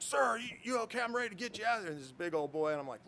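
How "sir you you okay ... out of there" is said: high-pitched, eager mock rescuer; urgent rescuer. Rescuer calling to the pilot from the ladder, urgent. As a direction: play an over-keen rescuer in a raised voice.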